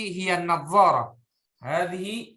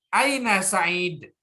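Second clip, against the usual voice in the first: ordinary talk, nothing marked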